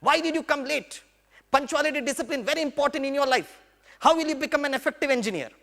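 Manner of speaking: high-pitched scolding of a late student; mock angry lecture to latecomers. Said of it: "मैं इतना जोर से भड़कता था".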